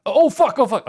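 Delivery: high, loud panicked cursing; panicked yelps. Real, not acted. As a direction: yelp it out in panic. Near death in game.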